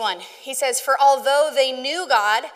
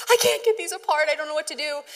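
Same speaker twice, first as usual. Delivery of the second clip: higher, mock-panicky friend voice; imitating a distressed friend; 4 semitones higher than usual. Friend Lindsay on FaceTime about knotted lanyards, mock panic. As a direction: play her near tears over the tangled lanyards, lightly. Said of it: "she's, like, almost in tears".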